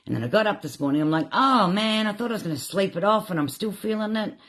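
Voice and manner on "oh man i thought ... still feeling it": whiny dismay. Retold or imitated. Retells morning complaint to herself.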